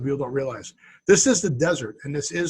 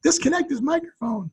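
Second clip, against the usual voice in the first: mock audience voice; 9 semitones higher than usual. Playing the cheering audience.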